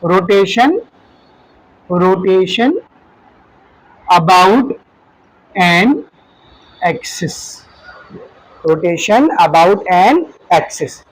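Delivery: very slow, word by word with long pauses; slow dictation. Shown as a demonstration. Teacher dictating the definition to students, very slowly. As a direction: spell it out slowly while writing on the board. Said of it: "जरा ध्यान से देखना".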